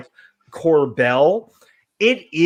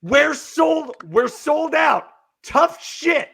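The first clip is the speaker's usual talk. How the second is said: high, slow, scornful mock shout at the customer; mock rude refusal; 8 semitones higher, slower than usual. Retelling the store manager to the customer, mock scornful shout. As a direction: play the smug manager snapping no.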